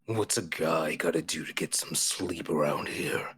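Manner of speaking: low breathy whisper of a grumpy monster head; male head voice. Acted. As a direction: rasp it out like a sleepy brute. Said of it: "says one head".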